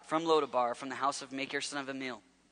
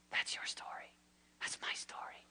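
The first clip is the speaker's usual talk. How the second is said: softer, hushed preaching; intimate whisper; 10 dB quieter than usual. Real, not acted. Preacher urging the congregation to meditate, whispered. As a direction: lean in and whisper the appeal.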